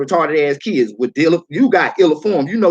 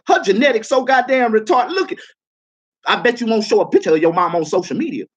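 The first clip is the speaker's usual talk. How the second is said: ordinary talk, nothing marked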